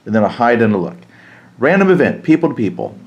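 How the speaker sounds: normal talking voice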